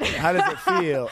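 loud, slow, laughing tease; loud teasing cackle-laugh, slow; laughing taunt. Real, not acted. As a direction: needle her through laughter. Teasing friend over the question.

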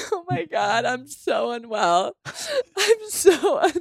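high, loud laughing sobs; laugh-crying. Real, not acted. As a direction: lose it, crying and laughing at once. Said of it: "She's losing it".

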